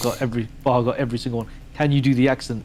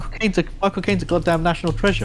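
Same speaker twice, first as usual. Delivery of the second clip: higher-pitched, indignant defence; 6 semitones higher, faster than usual. Real, not acted. Defending the actor to a friend, indignant. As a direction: protest as if a national hero was slighted.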